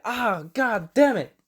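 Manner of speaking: high, slow, hammed-up groaning curse; mock frustrated curse. Mandalorian losing the fight, high mock-frustrated curse. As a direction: drag out the curse like a beaten fighter. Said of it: "He's like".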